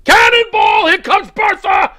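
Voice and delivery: high, loud gleeful yell; cannonball yell. Pretend. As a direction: whoop it like diving in. Acting out friend's cannonball.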